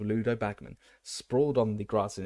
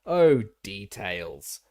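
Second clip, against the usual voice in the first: slow, loud, waved away; dismissive; 7 dB louder, much slower than usual. A narrator's reading. Bagman brushing off Crouch's details, slow and louder. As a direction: brush the word off lazily. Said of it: "waving the word away".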